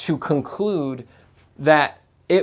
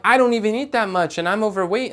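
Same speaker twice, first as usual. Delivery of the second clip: whiny client; 6 semitones higher, faster than usual. Retold or imitated. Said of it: "I'm like".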